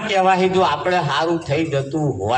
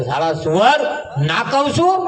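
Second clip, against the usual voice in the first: mock scolding teacher, rising higher; teacher with a ruler scolding the boy; angry teacher; 5 semitones higher than usual. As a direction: tell off the pupil like a furious schoolmaster. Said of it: "ફૂટ પટ્ટી લઈને ઊભા થયા અને કહે".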